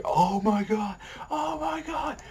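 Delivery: going nuts. Retold or imitated. Fan imitating everyone losing it after the buzzer beater, frantic shriek. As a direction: lose it like a crowd at a buzzer beater. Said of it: "like, just going absolutely nuts".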